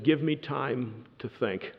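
soft, slow, hesitant plea; quavering, barely heard. Retold or imitated. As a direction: say it softly and slowly, unsure. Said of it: "with quavering voice that could barely be heard".

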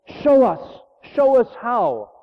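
high, slow, pleading prayer; praying sister. Retold or imitated. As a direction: plead upward to God, drawn out. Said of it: "no, she's praying".

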